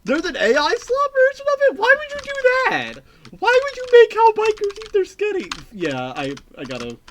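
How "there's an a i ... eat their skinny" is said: high, loud disgusted squeal; appalled squeal. Real, not acted. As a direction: shriek in horrified disbelief. Appalled at AI version.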